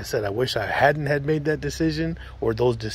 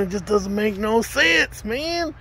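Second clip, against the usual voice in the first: exasperated; 9 semitones higher, slower than usual. Real, not acted. Exasperated outburst.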